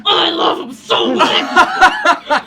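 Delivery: loud, laughing gush of delight; excited shout. Real, not acted. Player thrilled by NPC's deception.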